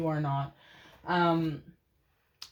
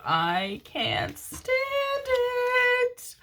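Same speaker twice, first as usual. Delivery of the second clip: overwhelmed squeal; much slower than usual. Real, not acted. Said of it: "oh my God, I'm gonna cry".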